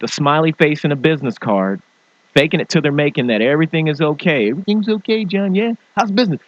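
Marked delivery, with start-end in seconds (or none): 4.5-6.4 high mock cheerful greeting; fake-cheery small talk. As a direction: fake it at a networking meeting.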